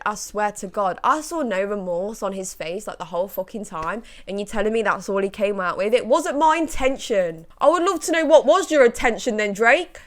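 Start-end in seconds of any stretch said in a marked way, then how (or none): 5.9-10.0 louder, higher angry demand; angry retort. Real, not acted. Vlogger angrily demanding answer from [name], loud. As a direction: fire the question at him.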